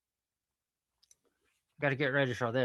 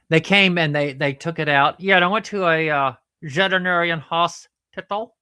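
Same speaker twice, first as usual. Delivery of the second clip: excitable puppet character; 3 semitones higher, 8 dB louder than usual. Acted. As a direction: blurt it out loudly as the puppet. Puppet character answers Sheila.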